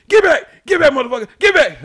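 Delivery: high, loud shouts at dogs; forceful, high-pitched warning yell. Retold or imitated. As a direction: bark commands to drive the dogs off. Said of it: "I'm yelling at these dogs".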